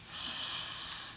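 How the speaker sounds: silent breathy gasp of awe; hushed gasp at the gift; soft gasp. Real, not acted. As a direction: catch your breath quietly. Unwrapping a gift.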